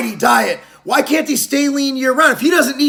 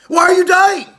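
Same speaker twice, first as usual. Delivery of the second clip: higher, louder yelling rhetorical question; exasperated shout; 7 semitones higher, 4 dB louder than usual. Real, not acted. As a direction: shout it at them in disbelief. Ranting at vegans.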